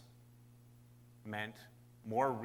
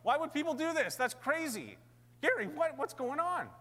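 high-pitched, louder mock disbelief; incredulous outcry; 5 dB louder than usual. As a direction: act out a crowd's baffled protest. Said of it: "We're like".

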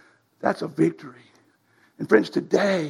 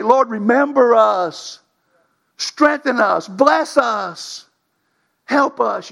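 pleading prayer; 4 semitones higher, much slower than usual. Pretend. Nation crying out to God.

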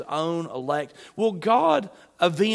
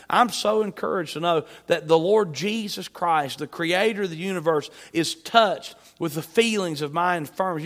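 ordinary talk

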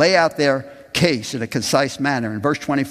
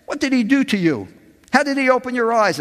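higher, pushy questioning Pharisees; pressing interrogators; 12 semitones higher, 4 dB louder, faster than usual. Acted. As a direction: demand answers, pressing hard.

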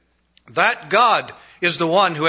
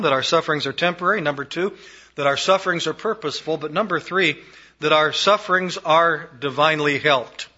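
usual speaking voice

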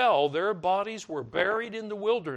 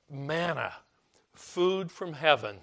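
ordinary delivery

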